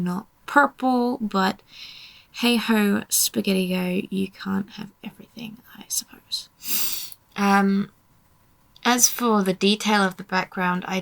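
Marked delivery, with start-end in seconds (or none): 4.1-7.1 hushed, slowing to a near-silent finish; resigned whisper. Real, not acted. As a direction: trail off into a soft whisper. Resigned aside, voice drops to whisper.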